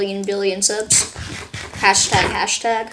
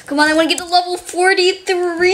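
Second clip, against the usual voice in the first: higher, pleading cry at the game; whiny frustrated plea; 8 semitones higher than usual. Real, not acted. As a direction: beg the game to let you level up.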